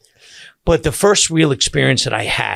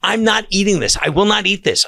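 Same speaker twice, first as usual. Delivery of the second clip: mom refusing; 8 semitones higher, 3 dB louder than usual. Retold or imitated. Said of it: "my mom goes".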